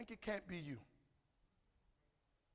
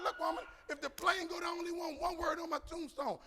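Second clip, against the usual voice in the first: high, loud comic plea to the wife; mock pleading shout; 12 semitones higher, 4 dB louder than usual. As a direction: belt it out like a desperate husband.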